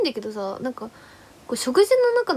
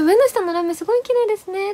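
higher, playful clerk voice; imitating the playful clerk; 8 semitones higher, 3 dB louder than usual. Playful clerk praising the under-eye glitter, higher friendly voice. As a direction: toss it out like a friendly compliment.

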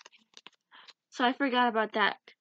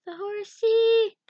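high, loud little squeal; excited squeal; 9 semitones higher, 5 dB louder than usual. Real, not acted. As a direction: squeal it at a cute animal. Cooing over a horse picture.

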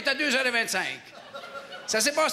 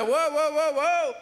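mock protest; 4 semitones higher, slower than usual. Mayor halts the envoy's plan.

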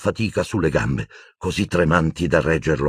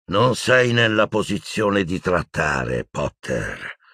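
drawling; slower than usual. Acted. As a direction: stretch it out, then drop the last word. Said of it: "disse con voce strascicata".

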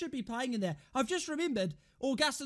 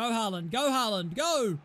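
ordinary talk

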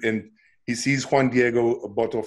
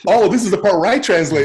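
excited realization; 7 semitones higher, 8 dB louder than usual. Retold or imitated. Realizing he must translate.

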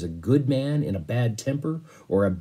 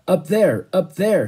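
higher, louder irritated snap; curt snap; 8 semitones higher, 6 dB louder than usual. Acted. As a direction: brush him off sharply. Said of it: "he snapped".

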